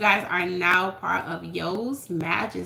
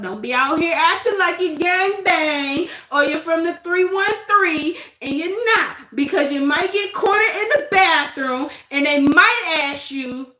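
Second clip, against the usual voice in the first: heated warning; 6 semitones higher, 4 dB louder than usual. Real, not acted.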